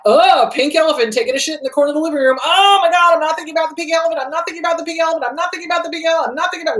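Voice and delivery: fast, high, panicky mock repetition; mock panic at the unwanted thought, rushed and high; frantic obsessing. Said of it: "So when we resist a thought".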